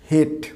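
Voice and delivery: hate as a short "het"; wrong pronunciation. Shown as a demonstration. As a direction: make hate rhyme with "get". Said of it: "This is not". (ভুল hate, ছোট "হেট")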